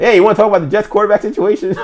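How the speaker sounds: high, loud, quick imitation; mock-eager caller. Colleague asks to talk Jets while they're losing, mock-eager. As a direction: pipe up fast with comic, untimely eagerness. Friend's eager call at bad times.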